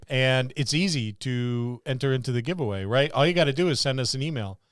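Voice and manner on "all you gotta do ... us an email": ordinary talk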